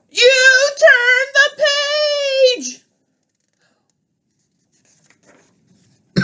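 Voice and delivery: very high, slow sung wail; dismayed Grover. Acted. Grover crying out that the page turned. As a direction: wail it in horror.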